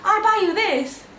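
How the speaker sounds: lightly voiced shopper offering a gift; eager woman. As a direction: press the gift on her kindly. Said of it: "And she goes".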